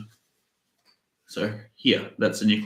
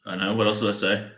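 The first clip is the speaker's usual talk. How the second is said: ordinary delivery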